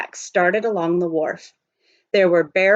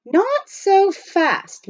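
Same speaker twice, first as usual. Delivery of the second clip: high, slow drawn-out call; Praiseworthy voiced; 12 semitones higher, 3 dB louder, much slower than usual. A narrator's reading. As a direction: hold him back with a slow high call. Praiseworthy stops eager Jack.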